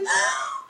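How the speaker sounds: high, hammed-up gasp of horror; shocked gasp. Daughter learning the truth from Mom, shocked gasp. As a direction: gasp like a devastated child.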